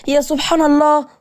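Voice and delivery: ordinary delivery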